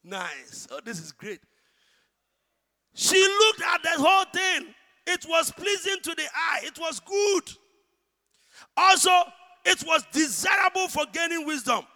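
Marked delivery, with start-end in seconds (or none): 2.9-11.9 loud, high preaching build over the fruit; fiery preaching. Acted. Preacher to congregation about Eve's eyes, loud high fervour. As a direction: preach it like she saw it all.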